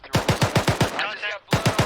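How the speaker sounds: slow, loud contact call; shouting. Real, not acted. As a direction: bellow the contact report. Calling contact under fire.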